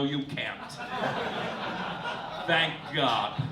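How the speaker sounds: ordinary talk, nothing marked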